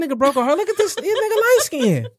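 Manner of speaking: high mocking tease of a friend; teasing. Real, not acted. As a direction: rib him with a high teasing voice. Teasing Zen loudly.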